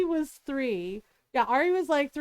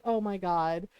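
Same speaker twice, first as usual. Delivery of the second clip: low, sinking oh my God; mutedly appalled, pitch dropped; exasperated; 8 semitones lower, 3 dB quieter than usual. Retold or imitated. Mom already face palming at her kid's scheme, exasperated. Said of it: "already face palming".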